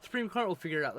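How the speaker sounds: high, quick, sarcastic reassurance; sarcastic mock confidence. Ranting to a friend about the court, sarcastic. Mocks trust in the Supreme Court.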